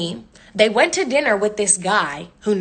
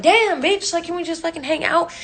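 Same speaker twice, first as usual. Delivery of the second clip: high-pitched, fed-up comeback; retold whiny protest; exasperated plea; 7 semitones higher than usual. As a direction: plead in exasperation, voice shooting up.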